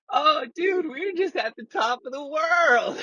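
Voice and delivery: high, drawn-out mock stoner squeal; giddy tripping voice. Acting out his tripping self.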